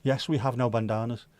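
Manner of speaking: normal talking voice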